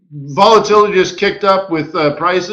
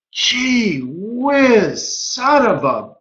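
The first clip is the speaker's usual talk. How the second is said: mock dismay; 3 semitones higher, 4 dB quieter, much slower than usual. Acts out banker's dismay.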